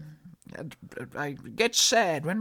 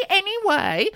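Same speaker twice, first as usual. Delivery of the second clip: anyway pitched way up and loud; emphatic anyway; 12 semitones higher, 7 dB louder than usual. Acted. Host cutting off her co-host, with a loud high anyway. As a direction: cut them off with a big anyway. Second anyway, much louder, higher.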